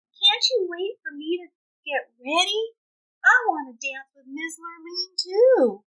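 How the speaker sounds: pleading Bubba. Acted. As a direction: ask them meekly, hoping. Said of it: "Bubba asked".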